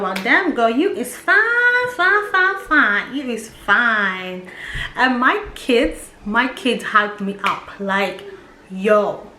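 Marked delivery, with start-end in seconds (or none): 0.0-4.5 high, slow, sing-song praise of herself; sassy self-hype. Retold or imitated. Hyping herself up in the mirror, sassy sing-song. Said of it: "look at myself and be like".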